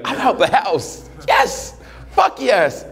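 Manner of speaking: high, giddy cheer about being free; mock giddy joy. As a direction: play the divorced guy thrilled to be out. Divorced guy's joy, imitated.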